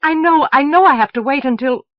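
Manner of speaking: loud, high, words tumbling out; rushing words. Acted. Moon to Elsevier, refusing bad news: loud rushing words. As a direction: rush it to beat back the news. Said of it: "words rushed out like birds".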